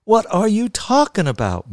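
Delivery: high-pitched, exasperated demand. A narrator's reading. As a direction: demand an explanation from Pop. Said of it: "Mal demanded".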